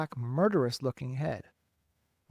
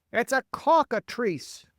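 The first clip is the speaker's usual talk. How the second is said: high, loud, drawn-out yell; 9 semitones higher, 5 dB louder, slower than usual. A narrator's reading. Police chief correcting the fire chief, yelling. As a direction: yell the monster's name in alarm. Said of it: "yelled the chief of police".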